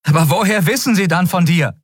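hohe, drängende Verzweiflung; verzweifelt. A narrator's reading. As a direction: frage hoch und verzweifelt nach. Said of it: "hörte die Verzweiflung ihrer eigenen Stimme". (verzweifeltes Nachbohren)

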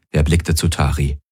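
ordinary delivery, nothing marked

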